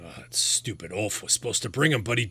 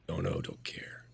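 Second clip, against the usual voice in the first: dismissive whisper; 14 semitones lower, 7 dB quieter, slower than usual. Acted. Captive answers dismissively.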